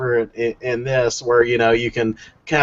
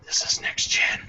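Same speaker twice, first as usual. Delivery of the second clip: awed whisper; 9 dB quieter than usual. Retold or imitated. Quoting an amazed gamer.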